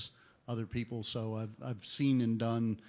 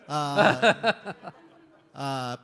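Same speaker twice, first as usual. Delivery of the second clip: laughing; 4 semitones higher, 11 dB louder than usual. Real, not acted. Laughing after his whoop.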